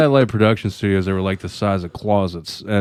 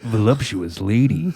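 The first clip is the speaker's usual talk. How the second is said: mock sleazy ad read; lightly put-on club announcer; strip club promo voice. Shown as a demonstration. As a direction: tease it like a club commercial. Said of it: "voices needed to properly promote, promote strip clubs".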